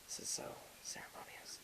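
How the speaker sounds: mock-reverent whisper, barely there; hushed whisper. Friend at a mock burial in the sand, hushed whisper. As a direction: whisper it like a solemn little rite.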